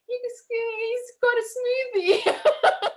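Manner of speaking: very high, drawn-out, laughing reply; mock strained squeal. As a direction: squeal it slowly as if mid-labour. Acts her labouring reply, laughing.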